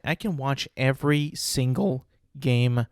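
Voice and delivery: normal talking voice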